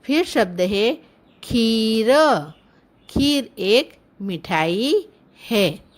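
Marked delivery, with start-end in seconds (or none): 1.4-2.1 खीर with a long, held ee; drawn-out teaching demo of खीर. Shown as a demonstration. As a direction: stretch the vowel in खीर. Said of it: "शब्द का उच्चारण थोड़ा लंबा होता है".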